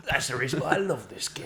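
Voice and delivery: lightly hammed-up cool comic, cigarette in mouth; mock cool-guy delivery. Mocking partner's stage catchphrase with a mild cool-guy swagger. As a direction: send it up like a smug stand-up. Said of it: "All that was missing is a cigarette out the side of your mouth".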